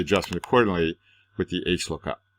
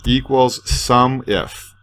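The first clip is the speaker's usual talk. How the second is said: dictating; 4 semitones higher, 8 dB louder, much slower than usual. Shown as a demonstration. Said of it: "I'm gonna say".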